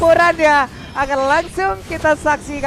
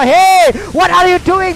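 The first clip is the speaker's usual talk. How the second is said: hammed-up taunt; 6 semitones higher, 9 dB louder, much slower than usual. Caster voices a hero's taunt.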